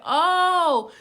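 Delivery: loud, high, drawn-out "Oh"; dawning realization. Retold or imitated. Storyteller voicing other girl realizing the truth, big high "Oh".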